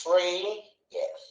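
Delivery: ఇండస్ట్రియస్ slowly split as "ఇన్... డ... స్ట్రి... యస్"; four syllables. Shown as a demonstration. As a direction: pause after every syllable of ఇండస్ట్రియస్. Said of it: "ఎన్ని వచ్చినాయి".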